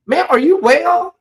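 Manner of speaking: high-pitched mock concern; incredulous scolding. Scolding an oblivious wife on screen.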